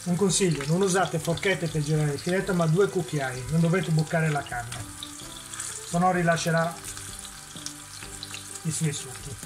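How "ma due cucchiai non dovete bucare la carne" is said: normal talking voice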